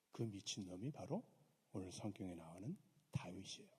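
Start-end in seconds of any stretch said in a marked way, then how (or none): 1.7-3.7 whisper. Real, not acted. Preacher reveals the key figure.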